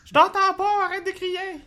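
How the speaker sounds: loud, high joking yell; mock shout. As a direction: yell it back as a joke. Joke about shouting.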